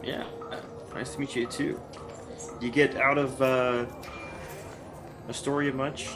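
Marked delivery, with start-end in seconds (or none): none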